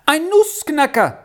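put-on very high little-boy voice; instant blurted answer. A narrator's reading. Little Hunne copies Jul's quick answers, blurting it high. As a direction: pipe up high without stopping to think. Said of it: "sagte Hunne unverweilt".

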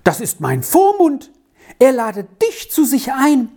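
high, bright schoolboy voice, slowing at the end; thrilled boy telling his friend the news; boy delighted for his friend's invitation; excited boy. A narrator's reading.

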